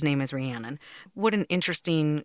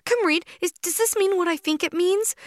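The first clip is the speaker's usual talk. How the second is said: much higher, louder, bursting with excitement; squealing disbelief at the back of the book; breathless retold thrill; excited retelling; 4 dB louder than usual. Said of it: "I said".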